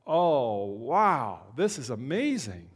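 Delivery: drawn-out, swooping amazement; awestruck. Acted. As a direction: stretch each word in wonder. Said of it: "there are no other words. It's just like".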